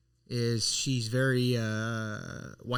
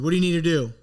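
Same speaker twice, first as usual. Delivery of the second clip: raised-voice repeat of the demand; retold coach getting louder; 4 semitones higher, 7 dB louder, faster than usual. Coach repeats demand from back of class, getting louder. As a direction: press the question, louder and higher. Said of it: "he keeps getting louder".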